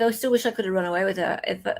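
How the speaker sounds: ordinary talk, nothing marked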